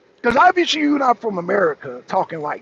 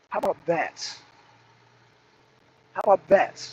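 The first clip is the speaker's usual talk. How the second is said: usual speaking voice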